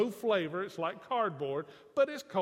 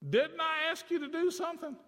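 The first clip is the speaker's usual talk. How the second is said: high-pitched mock scolding; acted parental reproach, pitched well up; indignant father; 8 semitones higher than usual. Father reminding son of the chore, indignant.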